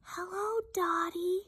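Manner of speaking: tiny, high, very soft voice; whispering quail. Acted. Quiet quail greets noisy duck friend, whispery and high. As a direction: answer as a small, quiet bird. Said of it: "whispers Quincy the quiet quail".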